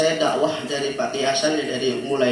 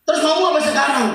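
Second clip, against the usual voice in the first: impatient Jibril; 13 semitones higher, 6 dB louder, faster than usual. Acted. Jibril demands.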